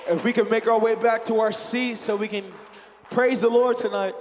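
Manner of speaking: loud welcome to the congregation; in front of an audience. Real, not acted. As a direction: project to the whole room. Church host greets congregation.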